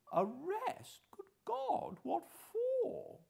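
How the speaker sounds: put-on the old man reels at the word arrest; shocked old man. A narrator's reading. Old man hears of the warrant, shocked and high. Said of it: "he looked really shocked".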